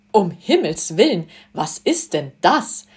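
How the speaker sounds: a lady suddenly spotting strangers below; mildly voiced shrill fright; shrill alarm. A narrator's reading. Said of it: "mit schriller Stimme".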